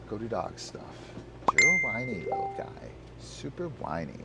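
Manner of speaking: soft grumbling at the dog; muttered teasing. Real, not acted. Owner quietly teasing his whiny dog. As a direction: mutter at him, half amused.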